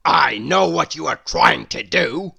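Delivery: Mister Grumpy grumbling at Little Miss Hug. A narrator's reading. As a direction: grumble it like a cross old man.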